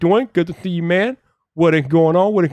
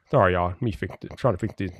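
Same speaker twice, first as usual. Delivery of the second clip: usual speaking voice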